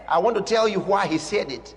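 usual speaking voice

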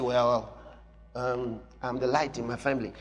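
low, soft, hesitant mock believer; timid believer. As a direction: shrink the claim down shyly. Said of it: "So you say".

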